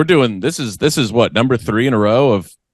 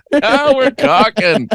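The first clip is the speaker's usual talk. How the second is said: drawn out delight; much slower than usual. Acted. Savoring the pun.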